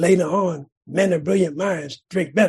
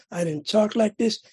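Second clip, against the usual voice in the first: normal talking voice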